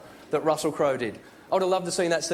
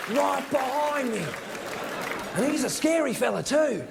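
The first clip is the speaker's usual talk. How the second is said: high, slow, hammed-up broad Australian wildlife host; Steve Irwin; 7 semitones higher, slower than usual. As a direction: declaim drawn-out like a crocodile hunter acting an epic. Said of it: "Steve Irwin attempt to act".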